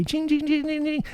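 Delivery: very high guitar-like jings; imitated high guitar. Mac mocking the high guitar parts with a squeaky guitar sound. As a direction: mimic a tinny high guitar lick. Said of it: "playing these high shit".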